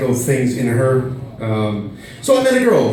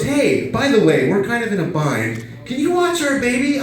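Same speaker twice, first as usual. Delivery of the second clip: friend; 8 semitones higher than usual. Retold or imitated. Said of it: "she goes".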